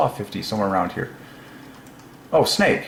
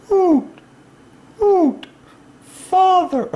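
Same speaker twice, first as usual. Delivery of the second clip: high, loud hooting like an owl; playful owl call, much higher and louder; owl hoot impression; 4 dB louder, much slower than usual.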